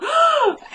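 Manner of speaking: sharp high gasp of fright; retold shocked gasp. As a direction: gasp as if finding someone hiding. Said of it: "And she goes".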